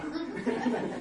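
short high laugh; real amused chuckle. Real, not acted. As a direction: laugh at yourself. Laughing about his singing.

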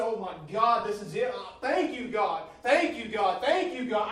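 loud, high joyful thanks; shouting to God while driving down the road; shouting praise. Retold or imitated. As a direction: cry out thanks to God at full voice. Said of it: "I was shouting going down the road".

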